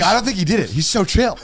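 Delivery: convinced police officer. Acted. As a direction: lightly act an officer won over by the suspect.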